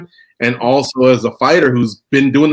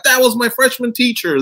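mock former student; 11 semitones higher than usual.

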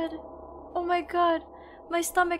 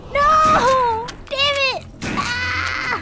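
loud, high desperate cries; pleading and shouting at the doomed pet; anguished yelling; 10 semitones higher, 4 dB louder, slower than usual. Real, not acted. Streamer yells at pet Hans not to swim, anguished.

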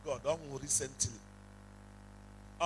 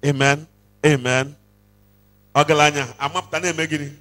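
much louder, fast call for a response; calling out to congregation; 16 dB louder than usual. Acted. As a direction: rouse the crowd to answer.

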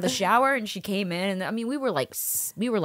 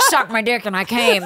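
laughing; 7 semitones higher, 5 dB louder than usual. Retold or imitated. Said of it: "he's like".

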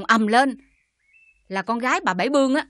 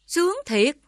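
higher, mocking sarcasm; sarcastic; 5 semitones higher than usual. Mother mocking her daughter's rumoured luck, sarcastically. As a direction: sneer it as a bitter joke.

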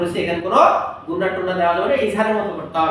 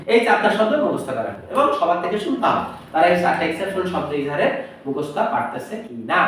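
ordinary talk, nothing marked

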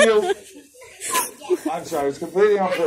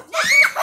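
high burst of laughter; 4 dB louder than usual. Real, not acted. Kid runs away.

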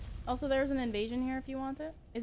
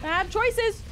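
loud scolding shout; 10 semitones higher, 6 dB louder than usual. Real, not acted. Yelling at player.

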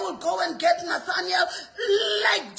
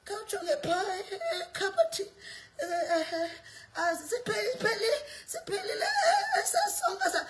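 mock complaining mother; 2 semitones higher, 6 dB quieter than usual. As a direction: whimper like a defeated mother, then squeal. Mimicking a weary complaining mother.

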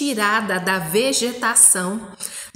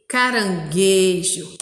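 caranGUEjo slowly, with GUE stretched and strong; stress on the syllable; 2 semitones lower, slower than usual. Shown as a demonstration. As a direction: lean hard on GUE in caranguejo. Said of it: "que forma a sílaba gue".

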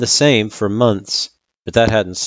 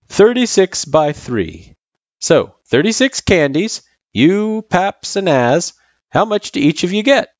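high, louder, playful quizzing voice; Sans, encouraging tutor; 11 semitones higher, 5 dB louder than usual. Acted. Sans quizzing Frisk with candies, encouraging. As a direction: lay out the puzzle for a kid.

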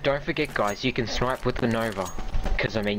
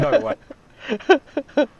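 high, loud "no way"; retold disbelief; 6 dB louder than usual. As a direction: replay the shock of hearing it. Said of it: "I was like".

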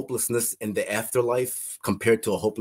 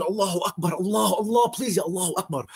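high, half-sung desperate cry to God; 8 semitones higher than usual. Retold or imitated. Man dying, begging God to save him, high wailing chant. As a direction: wail the prayer like a chant, pleading. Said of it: "And I'm still screaming".

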